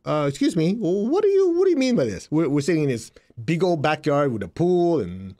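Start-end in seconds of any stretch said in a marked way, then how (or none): 0.1-2.3 puzzled question. Retold or imitated. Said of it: "I go".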